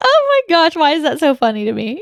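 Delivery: high-pitched, squeaky words through real laughter; helpless laughter. Real, not acted. Host cracking up at co-host's shower scare, helpless laughter. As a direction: barely get it out for laughing. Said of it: "I know I'm laughing".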